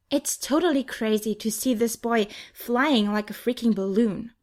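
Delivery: usual speaking voice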